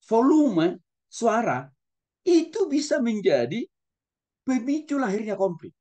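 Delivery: volume suara drawn out slowly, itu bisa menjadi rising high; slow, weighty emphasis on each phrase; emphatic slowed delivery. Shown as a demonstration. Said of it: "Intonasi saja". (penekanan lambat dan tegas)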